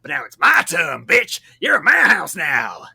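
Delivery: put-on loud shouting Southern accent. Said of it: "that stereotypical Southern accent".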